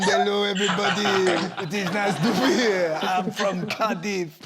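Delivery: hammed-up French-Welsh accent, slowing at the end; mock foreign Cardiff accent. Host mimics guest walking around the French school, mock French-Welsh accent. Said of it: "is that what you were doing?".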